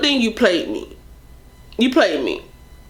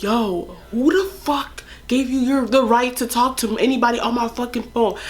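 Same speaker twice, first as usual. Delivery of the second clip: put-on mad boyfriend; 3 semitones higher than usual. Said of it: "he was real mad".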